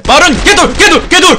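excited shouting. Real, not acted. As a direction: cheer the charge on, building up. Hyped up charging in game.

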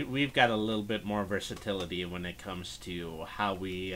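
ordinary talk, nothing marked

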